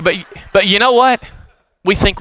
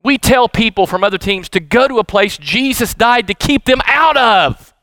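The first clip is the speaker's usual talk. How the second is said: usual speaking voice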